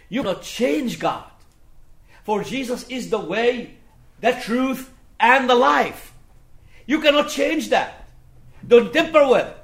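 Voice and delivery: loud, high-pitched sermon delivery; forceful preaching. Real, not acted. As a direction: preach it out with full conviction.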